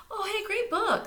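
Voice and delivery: put-on very high, loud cheery girl voice; breezy and carefree; chirpy girl. Tiffany cheerfully answering the crowd.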